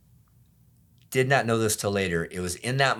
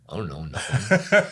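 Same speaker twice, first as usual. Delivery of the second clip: imitating an evasive young teen; mock sheepish kid, caught out and playing innocent; mumbly teen denial, played for laughs; thirteen-year-old kid; 4 dB louder than usual. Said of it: "I turned into, like, a thirteen-year-old kid".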